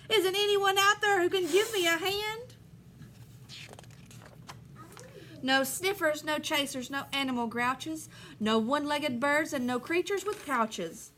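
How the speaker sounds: lively storybook read-aloud; reading to children. Acted. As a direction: read the rhyme to the class with bounce. Teacher reads picture book aloud.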